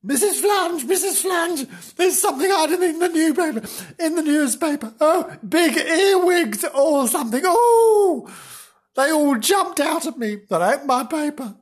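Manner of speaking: high-pitched panicked calling out; hammed-up startled character; comic fright. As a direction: cry out in exaggerated alarm, voice way up. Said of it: "Flange jumped up in a fright".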